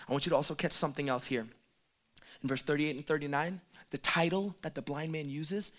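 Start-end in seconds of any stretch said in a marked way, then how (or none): none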